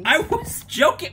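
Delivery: exasperated. Real, not acted. Defensive after argument.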